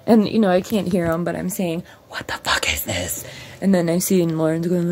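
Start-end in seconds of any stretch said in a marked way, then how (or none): 2.1-3.2 unvoiced, hushed whisper. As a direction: whisper it while peeking out the window. Watching landlord from window.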